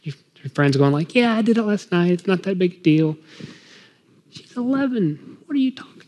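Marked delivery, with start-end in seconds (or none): 1.1-3.2 pressuring friend. Retold or imitated. 4.3-6.0 incredulous protest. Retold or imitated.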